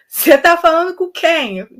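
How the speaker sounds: a voice yelling from another room; called from far off. Retold or imitated. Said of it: "uma voz gritando de longe".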